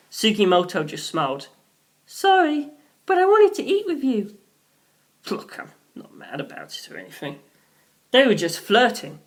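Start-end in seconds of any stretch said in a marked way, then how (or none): 2.1-4.3 put-on much higher young woman's voice, gentle; Sugimoto's voice. A narrator's reading. Sugimoto answers Rohan sweetly. 5.3-7.4 jealous muttered whisper; whispered aside. Acted.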